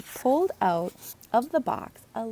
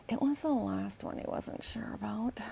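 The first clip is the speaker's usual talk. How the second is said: soft, unvoiced narration; whispered aside; 8 dB quieter than usual. A narrator's reading. Narrator whispers Logan's worry about poop. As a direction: hush the punchline.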